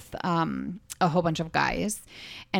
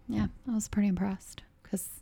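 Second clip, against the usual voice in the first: whispering; 4 semitones higher, 7 dB quieter than usual. Real, not acted. Whispered aside about the list.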